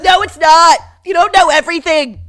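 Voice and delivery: loud, high defensive shout; loud defensive yelling; angry shout back. Retold or imitated. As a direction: yell back to defend yourself. Tom yelling back in fight.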